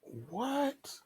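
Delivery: high, breathy disbelief; a sudden high gasp of disbelief; stunned what. Real, not acted. Speaker reacting to the pastor's claim, stunned.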